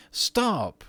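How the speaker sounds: sharp high cry of Stop; a girl crying out to a ghost; cried out. A narrator's reading. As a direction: stamp and cry out. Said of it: "cried Virginia, stamping her foot".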